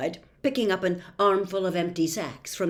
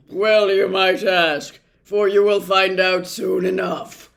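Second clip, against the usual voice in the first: ominous doctor; 10 dB louder than usual. A narrator's reading. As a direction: warn them gravely at full voice. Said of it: "as he sadly bade them".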